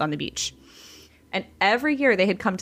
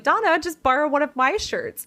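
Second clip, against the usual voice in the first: much higher, louder bossy aunt voice; Aunt Kathy; 8 semitones higher, 4 dB louder than usual. Retold or imitated. Aunt telling Donna to borrow her shirt, in a high, brightly bossy voice. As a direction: play the pushy aunt offering her shirt.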